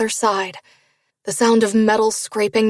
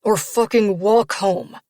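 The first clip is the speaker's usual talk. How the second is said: through clenched teeth; slower than usual. Acted. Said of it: "his teeth clenched together".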